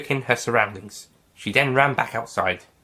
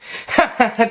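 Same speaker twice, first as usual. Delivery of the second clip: light laugh; 11 semitones higher, 3 dB louder than usual. Acted. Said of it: "Kate laughed".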